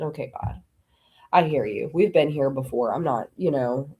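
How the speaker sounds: low, humbled reply; resigned acceptance. Retold or imitated. Answering God after finishing the dishes, low resigned acceptance. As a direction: give in, dropping the pitch well down.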